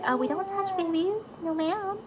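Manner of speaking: high, sing-song mom correction; mom to child. Retold or imitated. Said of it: "And I'm like".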